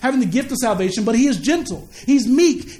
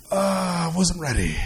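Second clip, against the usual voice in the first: lower, softer, slow, sheepish; caught unprepared; 6 semitones lower, 4 dB quieter, slower than usual. Pretend. An unready believer hearing the trumpet, sheepish. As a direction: mumble it like caught off guard. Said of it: "that's the wrong reaction".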